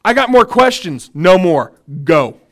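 mock stern God dismissing Moses; firm command. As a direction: send him off, done talking. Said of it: "God telling Moses".

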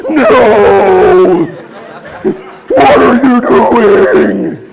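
loud, slow, panicked protest with a laugh; spray pack protesting. Pretend. The overfilled spray pack crying out to him, loud and slow. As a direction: play the pack begging him to stop. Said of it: "I actually heard the pack go".

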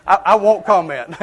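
laughing while holding back. Real, not acted. Preacher laughing as he refuses to comment, laughing. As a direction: chuckle through it.

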